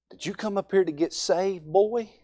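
put-on preacher coaxing a boy at the altar; kindly preacher asking. Shown as a demonstration. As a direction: draw the boy out with a rising ask. Counselor voiced in a demo.

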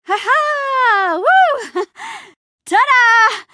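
high, whooping laugh and cheer; gleeful shout with laughter; triumphant celebration. Acted. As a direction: whoop and show off to the crowd. Celebrating before a crowd.

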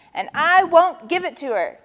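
high, loud, quick refusal; yelled. A narrator's reading. Vulture refusing in front of Lion, yelling. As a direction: yell it in defiance. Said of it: "yelled Vulture".

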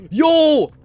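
very high, loud yell; startled cry at what he sees; sudden shrieking Yo; surprised shout. Real, not acted.

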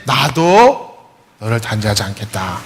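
gentle Jesus. Retold or imitated. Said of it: "그랬더니".

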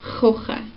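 "kochen" with a soft unvoiced ch from the back of the throat, just air; whispered air in "kochen"; breathy German ch. Shown as a demonstration. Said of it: "You just do".

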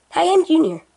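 Junior's put-on voice. Junior proudly reintroduces himself in his chosen voice. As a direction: go back to the silly high voice. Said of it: "I'll keep this voice 'cause I like this voice".

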